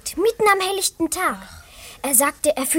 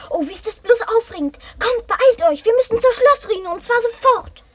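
thrilled; 6 semitones higher than usual. Acted. Thrilled by the pearl.

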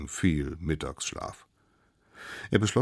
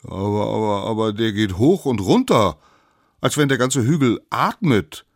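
louder, raised, stammering objection; puzzled little savages; 6 semitones higher, 8 dB louder than usual. Acted. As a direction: protest in bewilderment. (verwundert und lauter eingewandt)

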